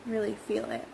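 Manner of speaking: ordinary delivery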